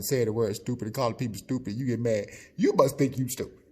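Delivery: ordinary talk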